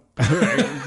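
a real, loud laugh breaking out; loud burst of laughter. Real, not acted. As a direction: laugh out loud at your own guess. Laughs at own guess.

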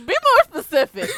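high, loud mock demand; mock squeaky demand. Five mocked demanding specifics, high and loud. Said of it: "he was like".

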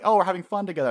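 high, loud, eager cheer; over-cheerful peacemaker. Acted. Usopp trying to reconcile with Nami, over-cheerful. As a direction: play up the fun to win a friend back.

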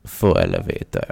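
deep evil-villain purr; Bond villain. Shown as a demonstration. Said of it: "I need to do it like I".

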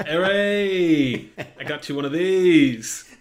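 loud, slow hammed-up cheer; skit character. In the magician skit, a hammed-up loud slow cheer. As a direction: cheer like a showman handing over a prize. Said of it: "our impression of Harry Kane's brother".

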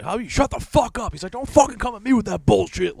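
hammed-up New York cop snapping at the press; imitating a furious cop. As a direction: yell it like a cop losing it. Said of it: "he's like ... he goes nuts".

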